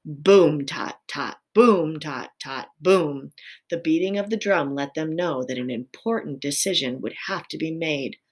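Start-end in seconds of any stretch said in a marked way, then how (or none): 0.0-3.3 imitated drumbeat. A narrator's reading. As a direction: drum it out in rhythm. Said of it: "One long beat with his tail".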